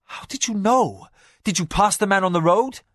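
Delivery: high, loud surprised question; a husband surprised by the spymaster, high and loud. A narrator's reading. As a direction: show sudden surprise. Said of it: "Hokanu showed surprise".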